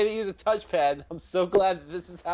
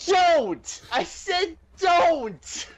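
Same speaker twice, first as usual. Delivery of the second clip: desperate yell; 11 semitones higher, much slower than usual. Real, not acted. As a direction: yell at the dice in despair. Dice rolling badly.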